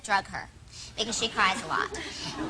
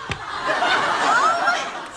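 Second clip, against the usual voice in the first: much higher laughing gasp of oh; a laughing, startled oh; shocked oh; 5 dB louder than usual. Retold or imitated. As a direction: squeak it out in shock.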